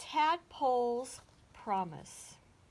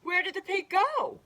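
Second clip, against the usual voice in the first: high, loud bewildered cry; 11 semitones higher, 8 dB louder than usual. A narrator's reading. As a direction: call out in dismay at a disappearance. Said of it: "the animals cried".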